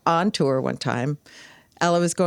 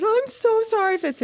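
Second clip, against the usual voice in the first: very high, hammed-up sobbing apology; milk the remorse like a ham actor; fake crying. [name] pretending to weep to Ella, hammed-up sorry. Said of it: "fake crying".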